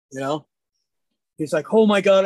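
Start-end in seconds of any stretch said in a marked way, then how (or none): 1.7-2.2 loud, acted burst of dismay; aghast exclamation. Shooter apologises after misfire, aghast. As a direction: blurt it out loudly as the retold shooter.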